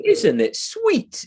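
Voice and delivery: high-pitched, drawn-out mock cooing; patronizing. Londoner fawning over the Northern accent, patronizing. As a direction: coo it slowly and high, talking down to them. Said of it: "I'm gonna sound patronizing".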